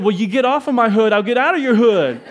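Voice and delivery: cocky made-up comeback shouted back; pretend comeback. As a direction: fire back like a tough guy. Imagined comeback to the locals.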